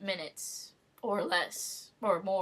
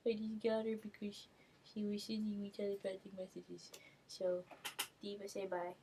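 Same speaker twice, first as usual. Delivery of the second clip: hushed, doting voice for the cat; soft cooing to the cat; 9 dB quieter than usual. Real, not acted. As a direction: coo softly to your pet cat. Cooing softly to her cat.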